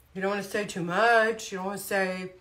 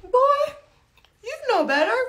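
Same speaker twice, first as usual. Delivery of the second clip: much higher, knowing scold; 5 dB louder than usual. Retold or imitated. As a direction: scold like you know better. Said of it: "and you're just like".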